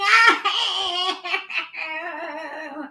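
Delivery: long high mock wail; imitated child crying. A narrator's reading. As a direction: wail like a bawling little boy. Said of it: "the boy was crying".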